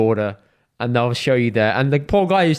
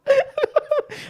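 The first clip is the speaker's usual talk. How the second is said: high laugh. Real, not acted. Laughing at uncle drill clip.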